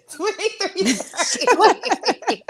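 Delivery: high, drawn-out words through laughter; helpless laughter. Real, not acted. Co-host calling out a timestamp, cracking up laughing. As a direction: squeeze it out slowly while cracking up.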